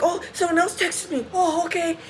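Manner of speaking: high, put-on mocking voice; mimicked busy spot-saver. Retold spot-saver getting a text.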